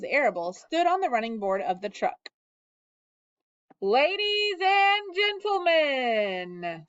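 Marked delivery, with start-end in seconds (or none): 3.8-6.9 higher, slow, grand fair announcer; pompous loudspeaker. A narrator's reading. Loudspeaker announcing the pig to the fair crowd, pompous and slow. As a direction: announce it like the fair's proud loudspeaker. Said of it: "said the loudspeaker".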